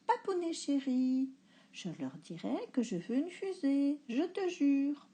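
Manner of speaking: put-on high, coaxing child voice; little girl pleading. A narrator's reading. Girl coaxing her papa, high and pleading. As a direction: sweet-talk daddy into it.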